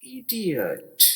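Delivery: teacher modelling इडियट slowly; slow model word. Shown as a demonstration. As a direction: present इडियट slowly and high for learners. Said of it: "अगला लफ्ज़ है".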